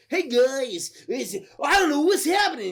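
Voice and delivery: lightly hammed-up cheery greeting; acted radio guy. Retelling the movie's billboard radio guy to a friend, acted greeting.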